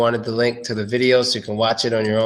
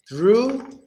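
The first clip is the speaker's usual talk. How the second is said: high rising; 8 semitones higher than usual. Shown as a demonstration. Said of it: "first word is through".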